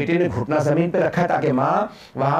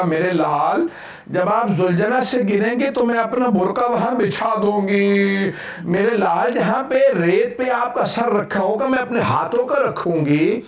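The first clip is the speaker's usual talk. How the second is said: higher, building preacher's lament; a grieving mother's promise, voiced by a preacher climbing in pitch; rising sermon recitation; 5 semitones higher than usual. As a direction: lift the voice as the mourning sermon swells.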